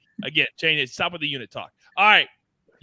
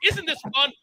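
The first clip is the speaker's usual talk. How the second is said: higher, lightly put-on wrestler voice; a playful taunt mid-move, pitched up; imitating The Rock; 7 semitones higher than usual. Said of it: "like, he goes".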